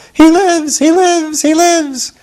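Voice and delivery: high, loud gleeful chant; rapturous cry. Acted. Witch Mombi dancing a jig in rapture, high gleeful cries. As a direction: crow it over and over in rapture. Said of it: "rapturously cried".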